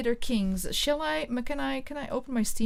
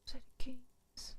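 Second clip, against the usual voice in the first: very soft murmur to self; absent-minded, hushed mumble; under breath; 12 dB quieter than usual. Real, not acted. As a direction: say it barely audibly while thinking. Mutters title while thinking.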